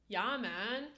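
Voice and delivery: light Toronto street accent; laid-back Toronto slang delivery. Shown as a demonstration. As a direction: imitate a casual Toronto local. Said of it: "Toronto accents are like".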